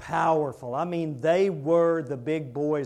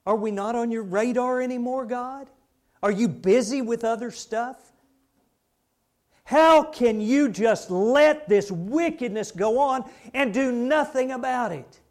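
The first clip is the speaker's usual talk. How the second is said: loud, raised, indignant questioning; Habakkuk; 7 semitones higher, 7 dB louder than usual. Retold or imitated. Prophet challenging God's inaction, in loud indignant questions. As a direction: demand an answer forcefully. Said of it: "So he challenges God".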